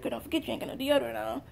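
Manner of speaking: soft, quick hushed reminder; a hint of Jesus whispering in her ear; retold whisper. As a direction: murmur it like a secret nudge in church. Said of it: "Jesus whispered in my ear again".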